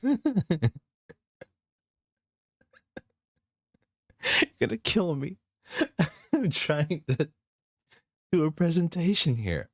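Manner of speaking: high, giggly plea through laughter; trying to get serious but still cracking up; laughing protest. Real, not acted.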